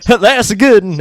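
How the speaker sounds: hammed-up hillbilly quip; mock hillbilly. Imitating hillbilly sketch.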